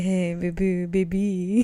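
lightly husky, soft come-on voice; a hint of a sultry flirt; sexy voice. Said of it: "It's like Phoebe's sexy voice".

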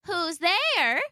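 put-on high-pitched old lady calling out; kindly old woman. A narrator's reading. Grandmother calling to the door, kindly old voice. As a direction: call sweetly in a high elderly voice. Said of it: "called out a kindly old voice".

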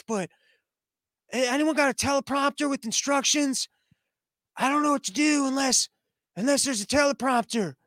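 a doddering president baffled by a keyboard; Biden impression. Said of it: "and he's like".

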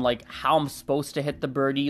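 normal talking voice

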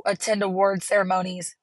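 normal talking voice

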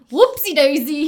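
loud playful whoopsie; mock fumble. Retelling Reed's trick to a friend, loud playful whoopsie. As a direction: toss it off like a fake accident. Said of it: "Reed's like".

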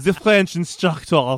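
drawn-out mock French accent; bad French accent. Co-host echoing the inspector's title, in a mock French accent. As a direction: put on a hammy French accent, slowly. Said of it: "That's a great accent".

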